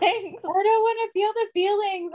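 high-pitched playful protest; mock whining. Real, not acted. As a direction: whine it high in mock refusal.

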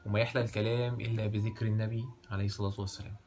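storyteller's formal opening; arabic cafe storyteller. A narrator's reading. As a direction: open the tale like a cafe raconteur.

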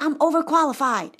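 high, loud mock-accented complaint; exasperated PhD in an imitated accent; attempted foreign accent. PhD explains his joblessness, exasperated imitated accent. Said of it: "I forgot his accent".

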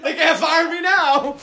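high, slow, laughing impression; hammed-up employee gloating; mock triumphant worker. Voicing the self-satisfied mall worker.

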